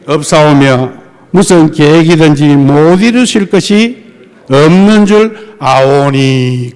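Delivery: slow, weighty recitation; solemn scripture reading. Acted. Pastor reading Job's reply to the congregation, solemn. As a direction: intone the verse with reverence.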